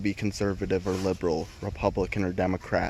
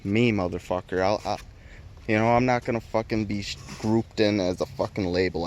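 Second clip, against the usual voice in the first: ordinary delivery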